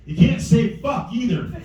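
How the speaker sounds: a hint of a kid announcing it; imitated young daughter. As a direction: lightly imitate a child laying down a rule. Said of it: "and she goes".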